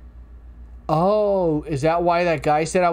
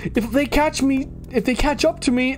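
high squeaky character voice; 9 semitones higher than usual. Acted. Cotton candy character pleading.

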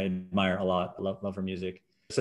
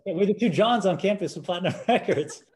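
laughing through the punchline, higher; 9 semitones higher, 3 dB louder than usual. Real, not acted. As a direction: crack up at your own joke. Joking about being [name].

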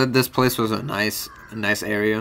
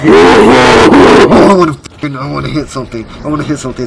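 furious roar; 10 dB louder than usual. Real, not acted. Raging after a loss.

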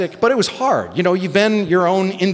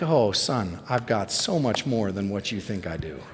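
soft, low, patient fatherly voice; gentle fatherly reproof; 9 semitones lower, 9 dB quieter than usual. Retold or imitated. God answering a man with small faith, gently reassuring. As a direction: lower and soften, as if tenderly correcting a child.

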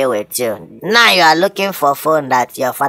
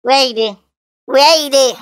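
frantic searching; 8 semitones higher, faster than usual. Acted. Searching for opponent.

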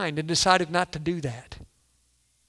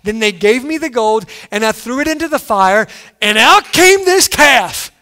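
rising, louder, wide-eyed retelling as Aaron; lightly voiced Aaron insisting the calf just appeared; sheepish Aaron; 3 semitones higher, 6 dB louder than usual. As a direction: play Aaron, amazed at his own excuse. Voicing Aaron's excuse.

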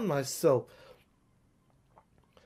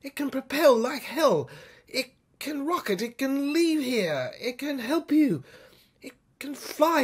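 boasting inventor; 6 semitones higher, 8 dB louder than usual. Acted. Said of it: "she said".

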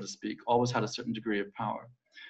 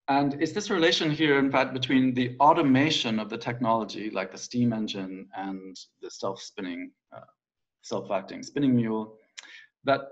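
ordinary talk, nothing marked

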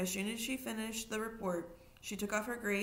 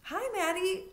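high, bright-voiced girl, as if nothing happened; breezy girl's greeting; 12 semitones higher, 5 dB louder than usual. A narrator's reading. Melody greets Maddie as if nothing happened, high and carefree. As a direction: chirp it out casually like a carefree kid. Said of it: "as if it was just another day".

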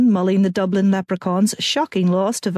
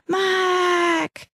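much higher yelled call of his name; shouting his name; 11 semitones higher than usual. Acted. Fangirl yelling for Mac through the loo door.

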